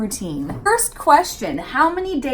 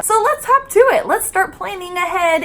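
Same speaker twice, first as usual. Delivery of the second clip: excited; 9 semitones higher than usual. Real, not acted. Upbeat kickoff, high and fast.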